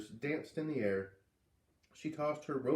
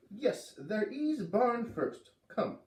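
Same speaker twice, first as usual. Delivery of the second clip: put-on high, slow, abrupt foreign old-lady voice; old Missus Falala; 9 semitones higher, much slower than usual. Old neighbor ordering the kids to the barn, high and slow. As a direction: bark it out slowly like a strange old neighbor.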